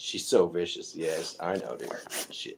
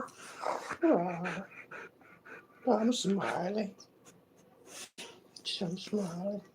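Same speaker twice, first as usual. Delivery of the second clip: high, contented pampered pet; spoiled pet voice; 3 dB louder, slower than usual. Pretend. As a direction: speak as a pet basking in attention.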